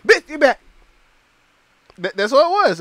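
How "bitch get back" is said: mock shove-off. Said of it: "That was a".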